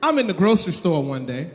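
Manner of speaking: ordinary delivery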